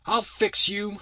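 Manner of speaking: put-on high-pitched kid making a threat; threatening kid. Kid to Bill after being hit, high threatening boy voice.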